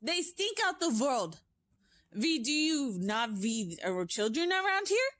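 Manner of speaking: high, loud, foreign-edged witch voice; witch crying out. Acted. Grand High Witch rants to the witches about children, loud high witch voice. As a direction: rant with disgust at the children.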